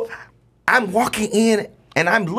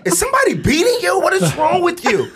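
high-pitched, incredulous parent; exasperated disbelief; 10 semitones higher than usual. Retold or imitated. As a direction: question your flinching son, voice pitched up. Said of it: "I'm like".

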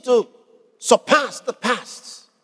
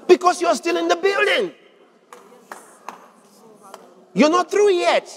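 high, insistent preaching call, slowing at the end; rousing exhortation; 8 semitones higher than usual. Real, not acted. Preacher urging congregation still building, rousing exhortation. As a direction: call it out high to rouse the crowd.

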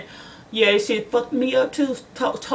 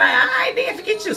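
dismissive brush-off; 10 semitones higher than usual. Retold or imitated. Brushing off the doorman.

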